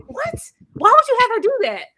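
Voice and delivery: high, fast disbelieving outburst; incredulous. Retold or imitated. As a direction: fire it off in disbelief. Incredulous rant at film.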